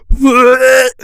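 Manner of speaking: high, loud gagging What; fake retching. Reacting to good boy text, loud retch. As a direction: gag like about to throw up. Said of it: "throw up sounds, trigger warning".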